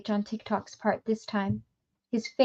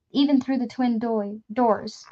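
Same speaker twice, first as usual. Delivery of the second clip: usual speaking voice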